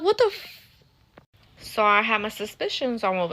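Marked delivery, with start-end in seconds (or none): 0.0-0.8 shocked disbelief. Retold or imitated. Dad refuses entry, shocked.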